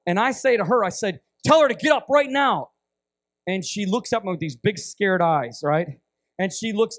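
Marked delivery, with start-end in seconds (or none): 1.4-2.7 raised, high, loud order; loud, emphatic retold command; forceful command. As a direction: call out the order with full urgency. Retold urgent order to interpreter.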